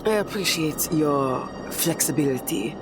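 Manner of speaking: put-on French accent. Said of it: "she leans into her French, uh, accent a little bit more".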